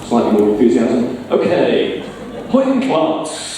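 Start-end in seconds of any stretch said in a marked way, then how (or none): 2.5-3.4 Hoyt class said high and drawn out in Geordie; Geordie phrase. Shown as a demonstration. Quiz host saying a Geordie phrase in Geordie. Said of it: "Geordie quiz".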